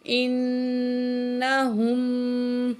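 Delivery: slow, drawn-out tajweed chanting; Quran recitation. Shown as a demonstration. Teacher recites the verse for students, slow melodic chant. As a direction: recite slowly with full nasal hum. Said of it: "تو نون میں ہم غنہ کریں گے".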